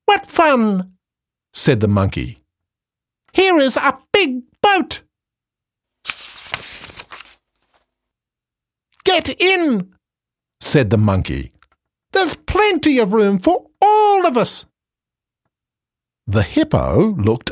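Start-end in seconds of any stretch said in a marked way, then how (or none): 0.1-1.0 very high, cheeky monkey; squeaky monkey voice. Acted. Monkey speaks in the story. 3.3-9.9 very high, cheeky monkey; squeaky monkey voice. Acted. Monkey speaks in the story. 12.1-14.6 very high, cheeky monkey; squeaky monkey voice. Acted. Monkey speaks in the story.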